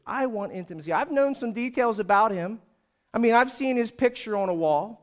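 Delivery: earnest, building sermon delivery; impassioned preaching. Acted. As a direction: testify as a seeker to the congregation. Preaching in a would-be believer's words.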